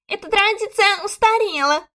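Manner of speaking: high squeaky protest; high-pitched, scandalised lady; shocked squeak. A narrator's reading. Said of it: "пропищала шокированная дама".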